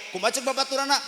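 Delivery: usual speaking voice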